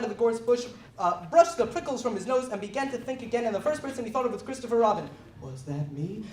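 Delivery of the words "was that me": lower, soft and full of wonder; hushed, amazed child; awed voice. A narrator's reading. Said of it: "in an awed voice".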